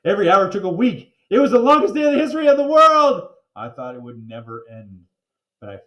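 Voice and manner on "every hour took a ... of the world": rising higher and louder to the top; building to a cry. A narrator's reading. Narrator building up the endless day, climbing to a cry. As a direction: build to a huge exclamation. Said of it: "building excitement".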